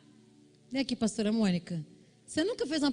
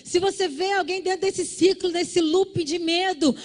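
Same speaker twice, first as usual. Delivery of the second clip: high, loud, urgent sermon; fervent high preaching; preaching at full force; 8 semitones higher, 4 dB louder than usual. Acted. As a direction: drive the lesson home to the church.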